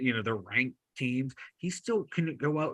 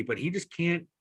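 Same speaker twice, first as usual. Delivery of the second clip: usual speaking voice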